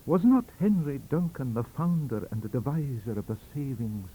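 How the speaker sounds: lightly voiced Scots professor; Scots lecture. Swinton lecturing the visitor, lightly Scots. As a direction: lecture them on the Savings Bank. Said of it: "said he".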